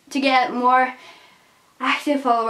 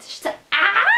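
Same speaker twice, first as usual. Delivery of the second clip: excited cry; 8 semitones higher than usual. Real, not acted. Sudden outburst.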